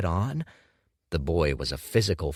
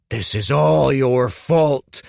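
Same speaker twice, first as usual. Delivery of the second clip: slow, louder, higher snarled blame; 7 semitones higher, 10 dB louder than usual. A narrator's reading. Grieving Kuruk snarls blame at Dorian, slow and loud. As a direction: snarl the blame through your teeth. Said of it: "Kuruk snarled".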